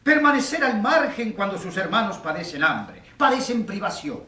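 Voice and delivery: high-pitched outburst from the public; indignant protest. Acted. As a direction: protest from the back of the courtroom. Spectator interrupts the trial indignantly.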